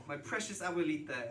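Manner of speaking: usual speaking voice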